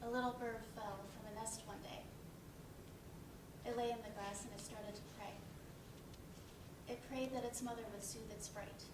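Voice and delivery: recited poem. Acted. Recited poem after blackout.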